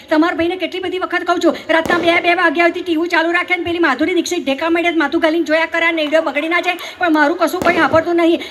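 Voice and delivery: nonstop mimicry of a rustic chatterbox; breathless run-on gossip in broad village dialect; breathless village friend. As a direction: rattle on without pausing, like a village woman unloading her chores. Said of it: "મારી આ બેનપણી બ્રેથલેસ talk કરે છે".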